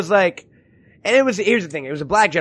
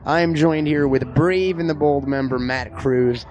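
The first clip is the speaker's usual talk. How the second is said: live reporter style; 5 semitones lower than usual. Acted. Live from the comic shop to the audience, reporter voice. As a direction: play it like a field reporter going live at an event.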